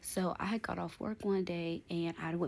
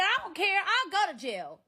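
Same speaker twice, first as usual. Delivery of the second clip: much higher and louder, careless yell; defiant shout; 12 semitones higher, 7 dB louder than usual. Retold or imitated. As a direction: throw it back at him at full volume. Shouting back at the ex.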